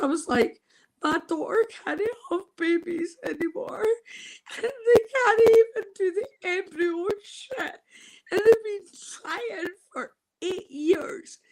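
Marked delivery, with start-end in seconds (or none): 1.0-11.4 high, tearful and breaking; fighting back real sobs; crying. Real, not acted. Telling the nurse about her daughter, crying.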